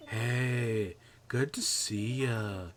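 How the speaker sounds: lightly voiced slow stoner drawl; laid-back slow surfer-dude voice. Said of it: "that's why I give him the stoner voice".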